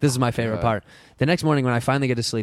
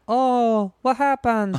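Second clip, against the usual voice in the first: surprised maid; 9 semitones higher, much slower than usual. Retold or imitated. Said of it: "she's like".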